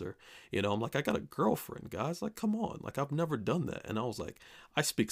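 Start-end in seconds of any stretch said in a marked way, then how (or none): 1.0-3.9 pitched way up, incredulous, almost laughing it off; like it's absurd anyone would think that of you; high, disbelieving come-on-guys protest; exasperated protest. Retold or imitated. Protesting he'd never flirt.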